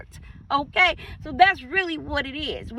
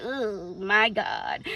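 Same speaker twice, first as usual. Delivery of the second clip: low, soft, slow groan; inner groan; 5 semitones lower, 6 dB quieter, much slower than usual. Retold or imitated. Jealous listener groaning inwardly, low and slow. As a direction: mutter it in private dismay. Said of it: "your inside is like".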